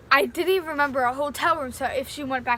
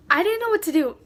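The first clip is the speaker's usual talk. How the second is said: higher and louder, at a loss; a kid's helpless cry; panicked outburst; 6 semitones higher, 6 dB louder than usual. Retold or imitated. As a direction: blurt it out in panic. Panic in tornado story.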